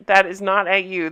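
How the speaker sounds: ordinary delivery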